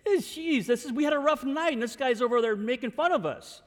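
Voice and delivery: aggrieved, high-pitched grumble; Peter. Acted. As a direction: complain in a high, quick rush. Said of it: "I imagine Peter's like".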